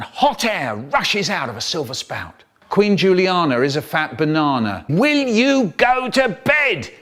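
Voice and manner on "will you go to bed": high, slow, pleading order; a mum at the end of her patience; exasperated mum. Retold or imitated. Mum scolding child at bedtime.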